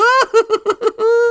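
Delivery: shrieking high maniacal cackle; Joker cackle. Retold or imitated. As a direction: let out a wild villain laugh. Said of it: "almost like Mark Hamill's Joker".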